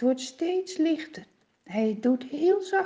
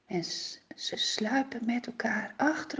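whisper; 8 dB quieter than usual. A narrator's reading. Narrator whispers as David's men sneak out.

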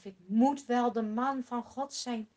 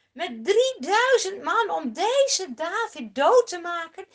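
ordinary delivery

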